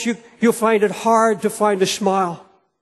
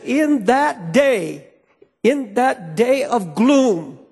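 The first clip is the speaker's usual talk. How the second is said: drawn out very slowly, word by word; solemn slow quote; 4 semitones higher, slower than usual. Acted. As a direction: stretch each word like a prophecy. Preacher quotes Malachi solemnly.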